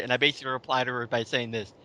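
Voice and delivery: ordinary talk, nothing marked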